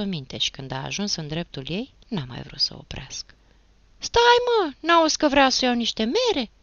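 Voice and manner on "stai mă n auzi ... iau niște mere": high, loud holler to a passerby; shouted call. A narrator's reading. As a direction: shout after him, insistent. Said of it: "a strigat femeia de pe scăunelul". (strigăt tare și înalt)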